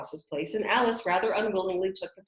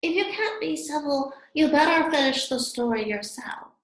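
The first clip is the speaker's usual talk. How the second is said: sulky; 8 semitones higher, 5 dB louder than usual. A narrator's reading. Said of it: "The Dormouse sulkily remarked".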